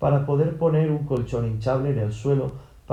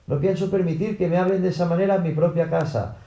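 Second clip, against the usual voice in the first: higher, indignant raised voice; indignant shout; 6 semitones higher than usual. A narrator's reading. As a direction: protest like the offended householder. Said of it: "Y el señor Shears gritó".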